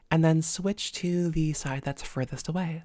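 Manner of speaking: ordinary delivery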